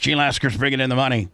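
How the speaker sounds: ordinary delivery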